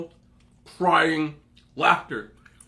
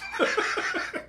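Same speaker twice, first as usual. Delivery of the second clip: laugh; 4 dB quieter than usual. Real, not acted. Cracking up.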